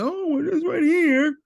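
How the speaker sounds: mock the naysayers in a squeaky high voice; high, loud parody of a doubter; mocking objector. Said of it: "some people would say".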